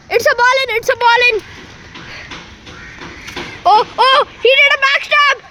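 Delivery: high, urgent match commentary; excited play-by-play. Real, not acted. Amateur commentator calling a ball in, excited play-by-play. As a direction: call the action loudly and high.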